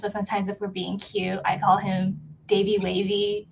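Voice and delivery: ordinary delivery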